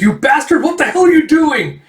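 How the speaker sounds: very high, loud mock outrage; outraged yell. Shepherd yells at man scaring his sheep, outraged. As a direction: act out a furious, high-pitched yell.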